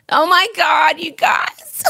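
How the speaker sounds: overwhelmed, tears and laughter spilling out; real laughing sob breaking into a shout; laughing and crying. Real, not acted.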